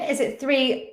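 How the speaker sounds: usual speaking voice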